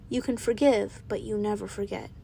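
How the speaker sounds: calm enough to unsettle friends; eerily calm. A narrator's reading. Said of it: "I say so calmly that I think".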